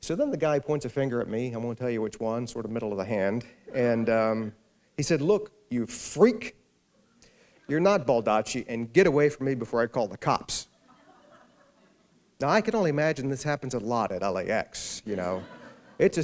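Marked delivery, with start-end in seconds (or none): 5.2-10.7 angry threat. Retold or imitated. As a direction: bark him away. Stranger angrily threatens police.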